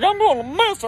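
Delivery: high, loud wail; mock drunken crying. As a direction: wail it like a drunk uncle in tears. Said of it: "You're crying 'cause you're missing the game".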